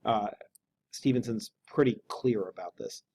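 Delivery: ordinary delivery, nothing marked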